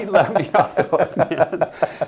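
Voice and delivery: laughing recollection. Real, not acted. Storyteller laughing about the priest letting him off. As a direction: chuckle it out fondly.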